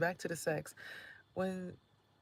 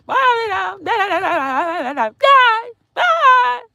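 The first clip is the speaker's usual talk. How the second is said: shrill mock goodbye; 21 dB louder, much slower than usual. Mocking loud neighbor's goodbyes.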